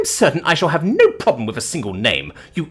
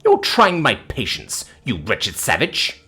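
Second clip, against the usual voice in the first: slow, haughty warning; indignant, deliberate scolding; losing patience; slower than usual. Acted. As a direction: draw the insult out imperiously. Dame losing patience with him.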